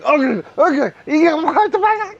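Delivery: high panicked goblin voice; a goblin losing its mind; freaked-out goblin. Acted. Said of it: "you hear the goblin saying".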